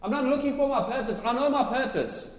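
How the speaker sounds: loud, high, happy proclaiming; joyful shouting. Real, not acted. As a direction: shout it out for joy, not anger. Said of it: "I'm shouting 'cause I'm happy".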